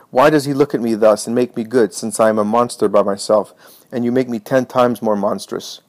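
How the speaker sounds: usual speaking voice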